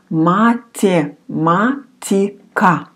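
Математика drawn out in five separate syllables; syllable-by-syllable pronunciation. Shown as a demonstration. Teacher repeats the long word Математика, slowly split up. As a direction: sound out Математика slowly, piece by piece. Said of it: "Quite long word".

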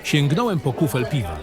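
ordinary delivery, nothing marked

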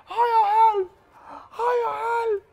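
high whimpering calls for help; sleep-talking cries. Acted. Dreaming boy calling his mother, high frightened whimper. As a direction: cry out weakly in a nightmare. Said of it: "he's sleeping".

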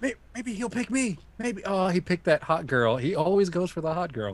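lightly voiced hopeful wannabe companion; hopeful then let down. Would-be companion waiting to be picked by the Doctor, hopeful then let down.

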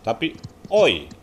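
"oi" slow, gliding from o to i; diphthong oi. Shown as a demonstration. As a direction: glide "oi" slowly. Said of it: "Tapi ketika digabung nggak O".

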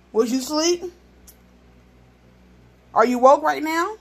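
loud exasperated question. Real, not acted. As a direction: demand an answer, exasperated. Scolding the toddler.

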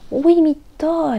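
slow, high, loud wonder; amazed exclamation. A narrator's reading. Albert sees the wish come true, amazed. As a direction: marvel at the magic. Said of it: "exclamă Albert".

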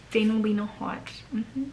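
breathy whispered repeat of her name. Acted. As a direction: whisper it like a secret.

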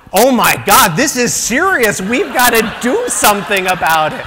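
hammed-up panic over pasta; over-the-top comic alarm; mock panic.

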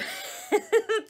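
short high-pitched giggle; a burst of amused giggling. Real, not acted. Laughs at own jibe.

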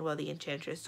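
ordinary delivery, nothing marked

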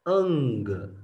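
normal talking voice

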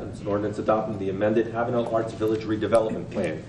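ordinary talk, nothing marked

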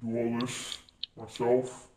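ordinary delivery

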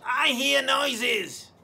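high, very slow, loud granny screech. Acted. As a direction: drag it out in a witchy granny screech. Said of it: "Granny screeched".

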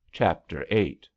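ordinary delivery

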